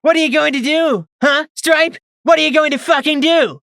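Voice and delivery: high, loud jeering scream; screamed taunt. A narrator's reading. Mare taunting the wounded zebra, screaming. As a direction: taunt her at full scream. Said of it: "she screamed".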